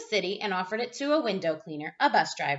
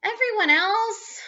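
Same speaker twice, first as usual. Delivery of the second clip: disbelief; 9 semitones higher, 4 dB louder than usual. A narrator's reading. Boy questions mother in disbelief.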